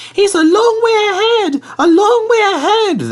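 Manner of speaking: very high, loud anxious wail; wailing coaches. Acted. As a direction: wail it out, fretting. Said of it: "they wailed".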